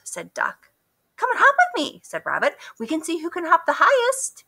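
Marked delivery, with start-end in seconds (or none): 1.1-4.4 much higher bouncy rabbit. A narrator's reading. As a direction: hop through it like an eager rabbit. Rabbit voice, high.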